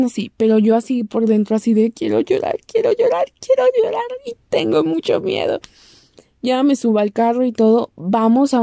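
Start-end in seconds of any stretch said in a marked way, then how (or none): 1.9-5.6 high whiny near-crying voice; whimpering. Retold or imitated. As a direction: whine like you're about to cry. Inner voice, wanting to cry. (voz aguda de llanto contenido)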